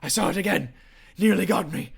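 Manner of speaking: high, loud, gasping panic; breathless, frantic. Acted. Exhausted scout confirms the sighting to his chieftain, breathless and frantic. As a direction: blurt it out between gasps, terrified. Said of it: "breathless and sweating and just completely out of energy".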